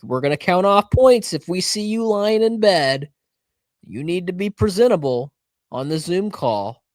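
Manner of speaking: mock a strict professor laying down rules; stern teacher voice. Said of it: "they're like".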